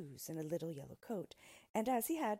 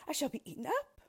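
higher, louder storybook alarm; mild frightened cry; 9 semitones higher, 6 dB louder, faster than usual. A narrator's reading. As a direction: voice a queen's fear, lightly acted. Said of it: "she cried".

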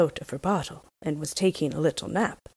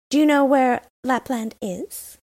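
put-on a lightly raised, bold robber girl voice; brash young robber girl, a touch higher; 8 semitones higher, 5 dB louder than usual. A narrator's reading. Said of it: "she asked the reindeer".

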